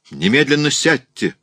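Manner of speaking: high, loud order; urgent command. A narrator's reading. Raoul orders madame to sit down, urgent shout. Alarmed man orders her down.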